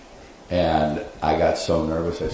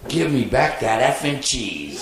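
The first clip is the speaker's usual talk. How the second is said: higher, slow cackling critter demand; creature yell; 6 semitones higher, slower than usual. Retold or imitated. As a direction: snarl for the cheese like a greedy little creature. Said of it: "I yell down at him".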